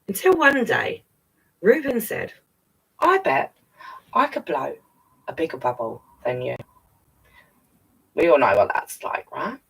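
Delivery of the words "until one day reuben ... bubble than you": storybook read-aloud, lively; gentle animated story narration; reading to children. A narrator's reading. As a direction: read it like bedtime to kids. Reads picture book again.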